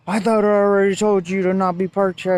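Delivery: mildly mock a cop telling someone off; retold yelling cop. Cop confronting a driver parked overnight, retold scolding. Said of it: "start screaming at me".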